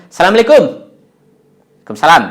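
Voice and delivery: Assalamualaikum rushed and clipped as salamlaikum; wrong clipped pronunciation. Shown as a demonstration. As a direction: swallow the syllables, the way not to say it. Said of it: "jangan mengucapnya". (contoh ucapan salam yang salah dan terpotong)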